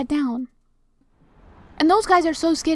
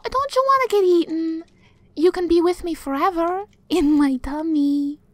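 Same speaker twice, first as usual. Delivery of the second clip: ordinary delivery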